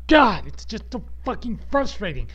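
high, loud burst on God; explode, then vent the frustration; frustrated outburst. Real, not acted. Podcaster unable to comprehend the failure, frustrated outburst.

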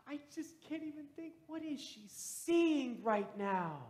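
retold tearful wonder. Granddaughter to pastor through tears, awed wonder. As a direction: imitate joy through tears, leaning into "seeing". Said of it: "with these tears and this smile".

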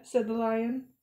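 ordinary delivery, nothing marked

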